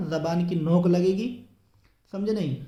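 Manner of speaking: normal talking voice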